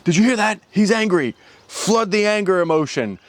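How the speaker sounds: high, urgent control-room call; brain's alarm operator. Acted. Someone pressing the button in my brain, urgent alert. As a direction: sound the alarm inside a head, pitched well up. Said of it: "someone presses the button in my brain that says".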